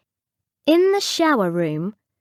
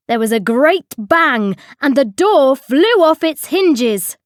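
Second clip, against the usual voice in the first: loud narration building higher; dramatic narration; 5 semitones higher, 6 dB louder than usual. A narrator's reading. Narrator telling how the door flew off, dramatic. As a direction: build to a loud, high crash.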